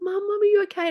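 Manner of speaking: put-on high, anxious child asking mum; imitating the kids checking on her; worried kids. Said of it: "And they're like".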